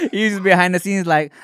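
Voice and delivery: ordinary talk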